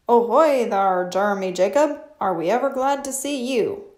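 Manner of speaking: loud hearty pirate greeting; pirate captain. Acted. Captain Braidbeard greeting Jeremy Jacob, loud and hearty. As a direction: boom it like a jolly captain. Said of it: "said Captain Braidbeard".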